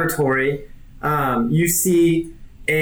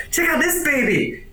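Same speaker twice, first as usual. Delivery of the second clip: high, excited show-off call; 11 semitones higher than usual. Acted. Cyborg showing off his cannon arm, high excited call. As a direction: brag about the new cannon arm. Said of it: "he's like".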